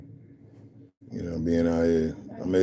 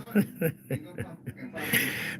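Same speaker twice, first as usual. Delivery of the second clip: soft high breathless giggle; airy giggle; 8 semitones higher, 8 dB quieter than usual. Real, not acted. Amused by a held chat message, soft airy laugh. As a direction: let a quiet airy laugh out.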